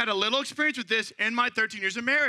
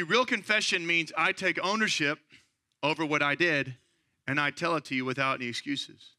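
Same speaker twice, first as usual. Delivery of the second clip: ordinary talk, nothing marked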